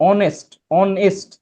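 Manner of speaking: "অনেস্ট" slowly split as on-est; slow syllable demo of "অনেস্ট". Shown as a demonstration. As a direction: say "অনেস্ট" in two separate beats, on, est. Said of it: "একের অধিক".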